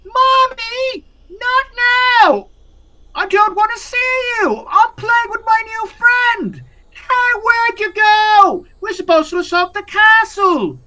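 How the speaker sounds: put-on high, loud child's yelling; possessed boy shouting at his mother; little boy yelling. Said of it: "the little boy yelling".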